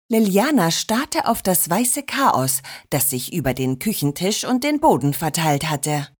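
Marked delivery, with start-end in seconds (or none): none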